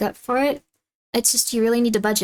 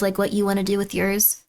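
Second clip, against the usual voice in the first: ordinary talk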